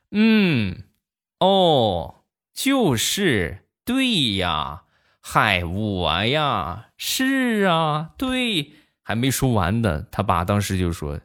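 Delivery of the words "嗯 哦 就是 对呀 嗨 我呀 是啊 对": put-on kid doing crosstalk. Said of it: "小家伙就开始了".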